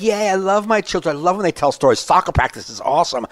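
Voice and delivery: put-on family cheer; fake enthusiasm. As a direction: gush falsely about the kids. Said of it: "be fake to your family".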